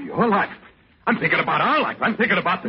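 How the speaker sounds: high, heated objection; indignant protest. Acted. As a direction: argue back urgently, pitched high. Husband protests in quarrel.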